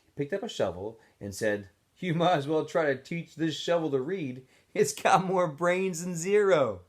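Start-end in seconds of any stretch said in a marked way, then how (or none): 2.0-6.8 mocking counselor. A narrator's reading. Counselor mocks Zero.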